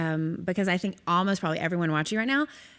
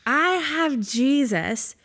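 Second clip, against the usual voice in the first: high, loud and slow, overwhelmed with wonder; excited outburst; 5 semitones higher, 6 dB louder, much slower than usual. Retold or imitated. Friend back from the visit tells Paige she's amazed, excited shout. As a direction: call out her name like your mind is blown.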